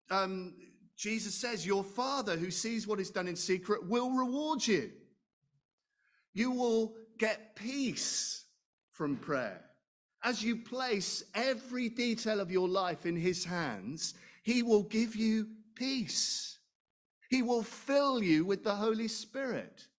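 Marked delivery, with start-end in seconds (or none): none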